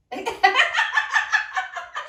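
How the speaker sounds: high, loud chosen laugh; a burst of laughter on demand; demonstration laugh. Shown as a demonstration. Showing the audience how he'll choose to laugh, high demonstrated laughter. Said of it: "It'll look something like this".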